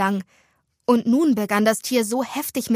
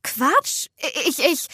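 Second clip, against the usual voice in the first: high, flustered stammer; flustered denial; 6 semitones higher, slower than usual. Acted. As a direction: protest and trip over your words. Startled friend stammers a denial. (erschrocken stammeln)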